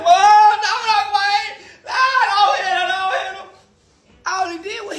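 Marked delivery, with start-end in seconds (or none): 0.1-3.5 put-on high, pleading little-kid wail; mock crying child. Boy crying to Uncle Wayne not to hit her, high pleading wail. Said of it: "crying like".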